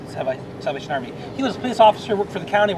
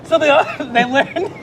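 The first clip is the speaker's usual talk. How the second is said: trying to carry on through laughter; higher-pitched, breaking up laughing mid-explanation; 9 semitones higher, 4 dB louder than usual. Real, not acted. Guest explaining the music camp to hosts who are cracking up, laughing.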